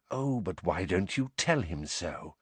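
soft, hushed taunt; Snape, whispering. A narrator's reading. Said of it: "whispered Snape".

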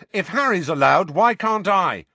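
loud, high indignant shout. A narrator's reading. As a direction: shout it in protest. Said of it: "shouted Ron".

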